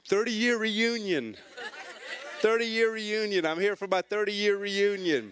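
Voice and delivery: high, insistent mock protest; hammed-up defensive announcement; defensive blurt. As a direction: call out an alibi for all to hear. Flustered excuse outside club, retold.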